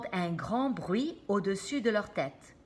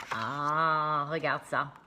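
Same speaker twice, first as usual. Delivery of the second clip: a lower, different voice; lower character voice; 5 semitones lower, slower than usual. Acted. Reader voices a character in a lower voice.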